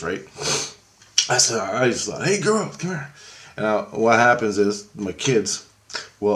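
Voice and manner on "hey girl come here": high-pitched, friendly summons; retold calling over. Father calls his girl over, high-pitched retold call.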